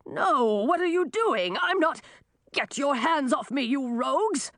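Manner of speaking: put-on high, protesting old man; high-pitched outraged cry. A narrator's reading. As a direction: protest in alarm as they seize you. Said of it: "cried the old man".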